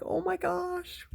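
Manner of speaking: high, soft, drawn-out gasp of wonder; hushed awe. Retold or imitated. Woman retelling the moment she saw her idol, hushed awe. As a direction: squeal it softly, stretching it out.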